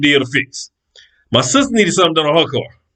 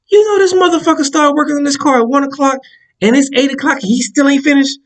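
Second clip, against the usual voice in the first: incredulous rant; 7 semitones higher than usual. Retold or imitated. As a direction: pitch it up in outrage. Outraged call to homeboy.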